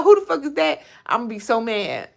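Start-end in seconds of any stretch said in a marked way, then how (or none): 0.0-0.8 mock indignant question. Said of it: "If they be like".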